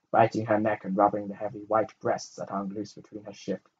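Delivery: usual speaking voice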